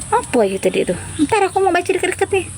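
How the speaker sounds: ordinary delivery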